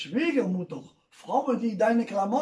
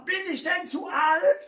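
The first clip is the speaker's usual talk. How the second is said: grandma's voice; 9 semitones higher than usual. Retold or imitated. Grandma asks in joke.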